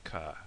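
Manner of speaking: "Ka" low and soft, open ah; Connacht pronunciation. Shown as a demonstration. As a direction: echo "Ka" quietly with a plain ah vowel. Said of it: "the Connacht dialect".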